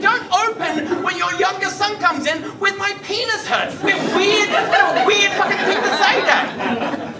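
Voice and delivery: high, loud comic scolding; exasperated outburst. Acted. Son telling dad off for his greeting, exasperated outburst.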